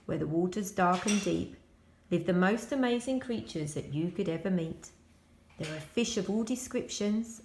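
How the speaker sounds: ordinary delivery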